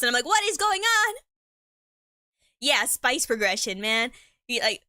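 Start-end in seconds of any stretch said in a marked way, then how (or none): 0.3-1.1 high-pitched, hammed-up disbelief; mock bewilderment. Reacting to extreme hot sauce videos, mock bewildered. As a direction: pitch it way up, baffled. Acted reaction to spicy TikToks.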